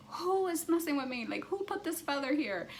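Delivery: high, panicky asking; rattled outburst. Retold or imitated. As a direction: demand to know who did it, voice up high. Freaking out over the feather.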